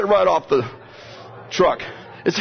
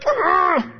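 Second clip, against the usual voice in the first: mock groan; 14 semitones higher than usual. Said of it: "They're like".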